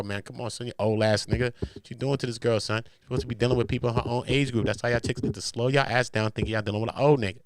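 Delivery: ordinary talk, nothing marked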